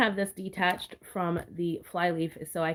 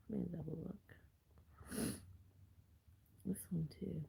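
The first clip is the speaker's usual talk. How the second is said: whispered; 18 dB quieter, faster than usual. Real, not acted. Whispering while checking.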